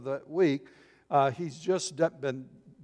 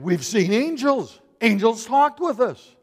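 high, loud, amazed announcement; excited women; 6 semitones higher, 6 dB louder than usual. Retold or imitated. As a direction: cry it out like women bursting with news. Women excitedly report seeing angels.

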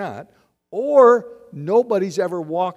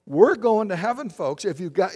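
usual speaking voice

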